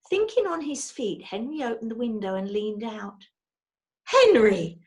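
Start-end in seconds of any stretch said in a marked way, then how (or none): 4.0-4.8 Missus Brown's voice, higher and louder, scolding; a wife forbidding her husband, raised and sharp; stern wife. A narrator's reading. Wife stopping husband from climbing out, alarmed scold. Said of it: "said Missus Brown".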